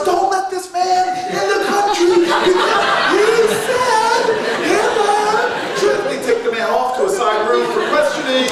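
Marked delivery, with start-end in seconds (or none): 0.0-6.0 high, loud frantic plea to officials; screaming. Retold or imitated. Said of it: "He's screaming".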